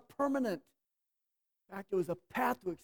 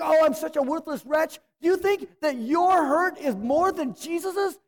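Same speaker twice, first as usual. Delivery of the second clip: loud, high, fervent challenge; preaching at full force; 6 semitones higher, 5 dB louder than usual. Acted. Pastor confronting self-pitying believers, fervent preaching. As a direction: press the listeners hard, rising urgency.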